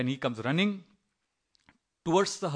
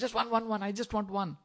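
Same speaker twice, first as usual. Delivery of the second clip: higher-pitched, acted pleading; desperate bargaining; 6 semitones higher than usual. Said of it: "that desperation for getting some relief".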